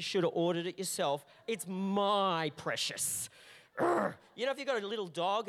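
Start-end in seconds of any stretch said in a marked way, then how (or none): 1.5-3.3 slow, possessive creature voice clutching food; guard the dish like a little monster; slow, greedy creature muttering over its food. Pretend. 3.8-4.1 loud, high grunt of annoyance; loud mock growl of irritation; annoyed growl. Acting the annoyed guard dog.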